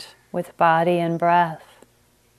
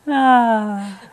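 a higher, louder sigh of amusement; settling laugh; 5 semitones higher, 5 dB louder than usual. Real, not acted. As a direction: exhale the last of the laugh. Winds down from laughing.

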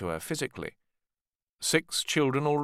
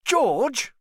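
sharp, high, louder surprised "George?"; exclaiming; 6 dB louder than usual. Acted. Richard hears George is invited, high surprised exclaim. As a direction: shoot it up in disbelief. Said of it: "Richard exclaimed".

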